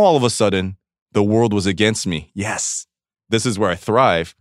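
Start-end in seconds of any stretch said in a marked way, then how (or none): none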